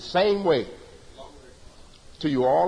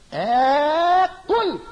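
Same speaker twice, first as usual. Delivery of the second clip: akul higher and louder, pressed down; stress on akul; 5 semitones higher, 3 dB louder than usual. Shown as a demonstration. Teacher emphasises who is speaking, stressed akul. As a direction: hit akul hard, like a proclamation. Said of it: "The emphasis is on who's saying".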